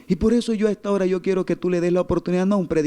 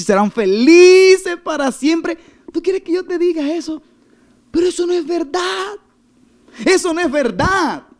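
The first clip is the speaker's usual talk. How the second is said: much higher, drawn-out preacher's cry; emphatic rebuttal; 11 semitones higher, 7 dB louder than usual. Retold or imitated. Pastor refuses false comfort to a woman, emphatic rebuttal. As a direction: cry it out in high, emphatic protest.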